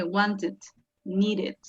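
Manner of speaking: "wanted, needed" with an extra final syllable, "id". Shown as a demonstration. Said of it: "como una I D".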